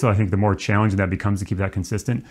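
ordinary delivery

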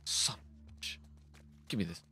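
hushed, breathy swear; whispered curse. Real, not acted. Gamer cursing quietly at a hidden zombie, whispered. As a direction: curse under your breath.

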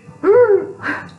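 bright burst of laughter; high giggle. Real, not acted. Narrator laughs with kids.

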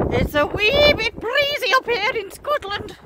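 high, slow hammed-up Scottish accent; put-on Scottish accent. Joking about the storm in a mock Scottish accent. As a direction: play up a bad Scottish accent. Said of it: "It's a terrible accent".

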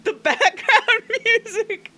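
high squealing laughter through the words; laughing. Real, not acted. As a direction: crack up mid-sentence. Laughing at the background music.

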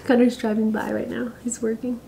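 whispered aside, breathy and hushed. Real, not acted. Whispering to viewers as her husband drives past, hushed whisper. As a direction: whisper it like he might hear you.

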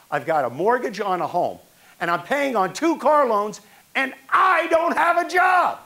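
rising from firm to a high, loud shout on the last line; building to a shout. Retold or imitated. Fired executive shouting back at the angry kid, building to a shout. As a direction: pile up the burdens, then explode. Ex-boss snaps back at complainer.

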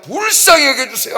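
loud, high pleading cry for mercy; imitated crying out. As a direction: cry out desperately like the blind beggar. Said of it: "하고 손을 지르잖아요".

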